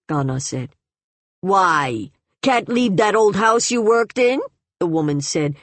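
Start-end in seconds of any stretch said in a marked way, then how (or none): 1.4-4.4 higher, louder teasing challenge; jeering, humoring. A narrator's reading. Woman mocking grandmother's old house, jeering. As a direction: jeer at her as if humoring her. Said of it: "jeering, humoring".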